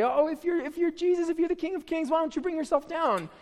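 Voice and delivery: high, sneering taunt; mocking crowd. People at the cross mocking Jesus, high sneering taunt. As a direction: taunt him like a jeering onlooker. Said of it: "were gonna say".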